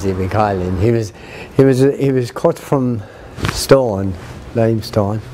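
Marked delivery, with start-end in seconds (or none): none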